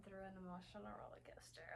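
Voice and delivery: hushed whisper about the book. Real, not acted. Reader whispering her reaction to the book, hushed. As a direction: whisper it like a confession.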